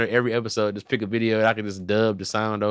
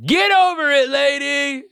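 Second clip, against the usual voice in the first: much higher, louder mock comeback; mock yelled retort; 5 dB louder, slower than usual. Teen snapping back at mom over her hair, mock yell. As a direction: fling it back at her cheekily.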